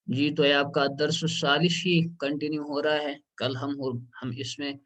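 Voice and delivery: ordinary talk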